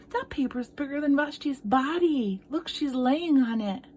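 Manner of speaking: ordinary delivery, nothing marked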